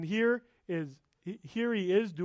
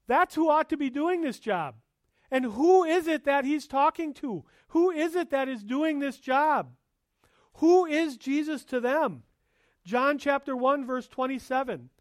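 high, loud, building pulpit emphasis; impassioned sermon climax; fervent preaching; 7 semitones higher, 5 dB louder than usual. Acted. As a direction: drive the point home to the congregation. Preaching at full force.

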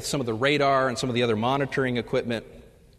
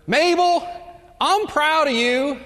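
much higher, loud and slow, straining; shouting with all he has; 14 semitones higher, 6 dB louder, slower than usual. Retold or imitated. Old husband yells his love to his deaf wife. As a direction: bellow it with everything he's got. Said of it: "said with all he could muster".

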